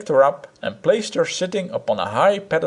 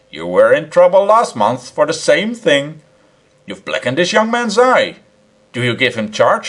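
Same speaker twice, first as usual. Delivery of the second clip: stern scolding; 4 semitones higher, 5 dB louder than usual. Acted. Policeman scolds the professor.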